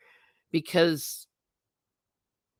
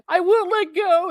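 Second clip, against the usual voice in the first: mock Rose line; 13 semitones higher, 5 dB louder than usual. Mock Titanic quote.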